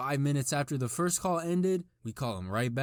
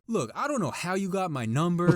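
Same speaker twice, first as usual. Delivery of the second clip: retold shouting; 6 semitones higher, 4 dB louder than usual. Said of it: "He starts shouting back".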